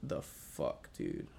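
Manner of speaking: slow, hushed and spaced out; acted-out quiet shock; stunned disbelief. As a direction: let it drop out quietly, word by word. Stunned reaction to gray-skinned man.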